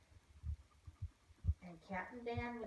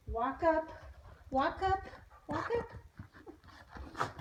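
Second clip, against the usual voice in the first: loud, higher herding commands to the dog; herding call; 6 semitones higher, 6 dB louder, much slower than usual. Retold or imitated. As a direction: call it across the field like a shepherd. Dad calling herding commands.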